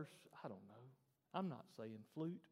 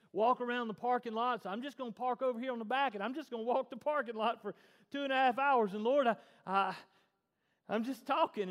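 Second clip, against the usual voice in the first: loud, raised, run-on pleading to God; fervent prayer; 5 semitones higher, 10 dB louder than usual. Acted. Volunteer praying in the parking lot, fervent prayer. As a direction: pour it out to the Lord.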